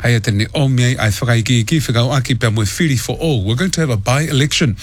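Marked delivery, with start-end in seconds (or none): none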